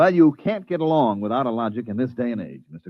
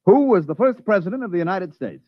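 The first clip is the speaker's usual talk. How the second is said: asking the machine; 6 semitones higher than usual. Shown as a demonstration. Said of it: "like this".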